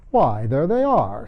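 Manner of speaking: slow, loud gloating king; triumphant king. A narrator's reading. King to the court, pointing at the tarts in triumph. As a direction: crow it like you solved the case. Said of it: "said the king triumphantly".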